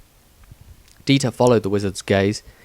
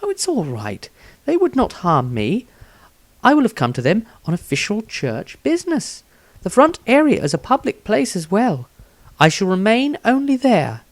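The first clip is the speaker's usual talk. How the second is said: put-on higher, gentle, reassuring young woman's voice; young priestess; 10 semitones higher than usual. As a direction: soothe the halfling, polite and calm. Initiate Rosandria speaks.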